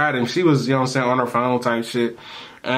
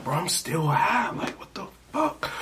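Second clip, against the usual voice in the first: panicked whisper; 6 semitones higher, 7 dB quieter than usual. Retold or imitated. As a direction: whisper it, scared to still be high. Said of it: "I'm scared as shit".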